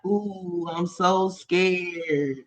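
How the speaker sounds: ordinary talk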